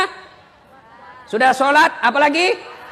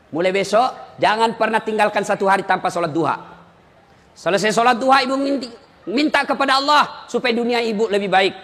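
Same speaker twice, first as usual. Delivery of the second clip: ordinary talk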